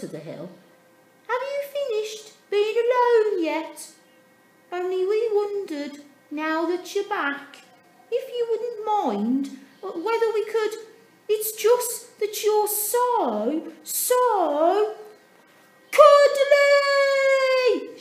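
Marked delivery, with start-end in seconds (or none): 1.3-15.0 high, loud, hesitant little voice; timid penguin. A narrator's reading. Penguin calls up to Dudley, high and hesitant. 16.0-18.0 very high, much louder shout; joyful shout. A narrator's reading. Dudley shouts happily to his family. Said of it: "shouted Dudley".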